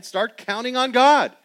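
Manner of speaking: high, loud, slow preacher's call; rally the congregation; preaching at full force. Acted.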